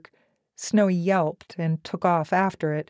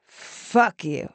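deliberate insult through a hard smile; smiling jab. A narrator's reading. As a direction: relish it, smiling. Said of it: "smiling hard".

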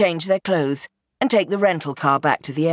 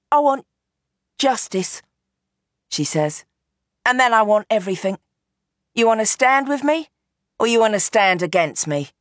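put-on higher, forceful young woman pressing for justice; Roxy, fierce demand; 5 semitones higher than usual. Roxy confronts the men, a fierce higher demand. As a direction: push him to choose a side.

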